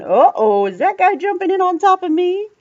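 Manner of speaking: high, loud worried fish voice; alarmed little fish. Acted. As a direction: squeal it like a startled little fish. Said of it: "the fishy's going".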